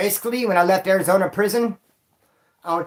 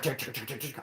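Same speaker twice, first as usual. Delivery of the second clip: very soft clicking tick sounds; soft mock helicopter ticking; 4 semitones lower, 15 dB quieter than usual. Describing the hunt overhead, quiet ticking helicopter imitation. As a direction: tick it out under your breath like rotor blades.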